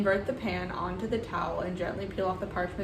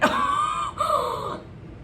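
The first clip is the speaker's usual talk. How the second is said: high panicked laugh-shout. Real, not acted. Cake breaking, panicked.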